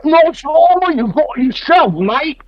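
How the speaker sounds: a cartoonish outback bounty hunter paying a compliment; hammed-up Aussie Boba. Voicing Boba's Aussie compliment.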